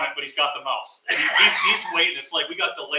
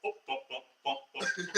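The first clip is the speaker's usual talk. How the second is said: soft, repeated cursor blips; imitated backspace boops; 3 semitones higher, 10 dB quieter, slower than usual. Storyteller imitates part number being erased, soft little boops. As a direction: boop softly like characters being deleted. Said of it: "all of a sudden it's like".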